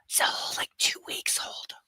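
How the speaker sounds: soft snarky whisper; whispered aside. Real, not acted. Streamer whispering a snide aside to chat. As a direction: whisper it as a sly jab.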